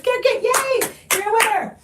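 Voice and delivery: high, bright cheer; cheering yay; excited. Real, not acted. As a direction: celebrate the winner. Celebrating the winner.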